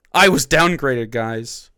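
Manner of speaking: loud opening, mildly aggrieved mimicry; mock griping. Host mimics comedian complaining of downgrade, mock aggrieved. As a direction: lightly parody a man stuck on his grievance.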